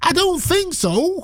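very high, slow American accent. Shown as a demonstration. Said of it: "American accents".

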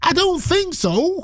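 very high, slow American accent; squeaky American twang. Shown as a demonstration. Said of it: "Or should I say".

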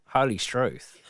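laughing, hammed-up Australian accent; a shaky Australian accent, giggling through the catchphrase; Harold Bishop catchphrase. Weatherman does a TV character in a mock Australian accent. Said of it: "what did Harold Bishop say?".

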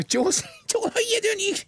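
high, laughing hello; excited greeting. Real, not acted. Radio host greets caller Joe, high and laughing.

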